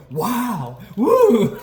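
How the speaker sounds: high, loud mock wonder with a laugh; hammed-up amazed crowd; duped gawker. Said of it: "people going".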